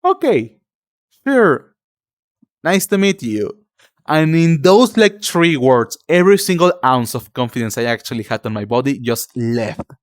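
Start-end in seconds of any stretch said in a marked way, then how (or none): 0.0-3.6 higher, clipped polite brush-off; a polite but cold reply; unimpressed stranger. Retold or imitated. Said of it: "And they were like".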